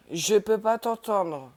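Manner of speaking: loud, slow call. Real, not acted. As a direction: raise the voice to reach a friend. Calling to friend Lina. (appel fort et lent)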